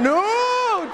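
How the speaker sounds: high, drawn-out wail; panicked refusal. Retold or imitated. Jumper refusing as instructor counts down, panicked wail. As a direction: stretch it into a pleading cry. Acted panic before being thrown out.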